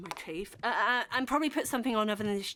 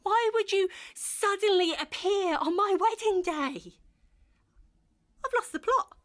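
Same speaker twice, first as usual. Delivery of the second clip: higher and louder, losing it; hysterical outburst; 7 semitones higher, 6 dB louder than usual. Acted. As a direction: rant in rising panic.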